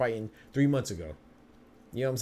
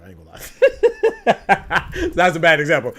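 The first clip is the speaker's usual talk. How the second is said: drawn out and high, cracking up; real laughter breaking into the line; laughing; 7 semitones higher, 13 dB louder, slower than usual. Real, not acted. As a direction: laugh through the words at your own slip. Laughs at his own example.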